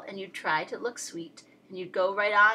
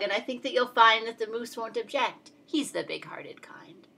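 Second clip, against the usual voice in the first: usual speaking voice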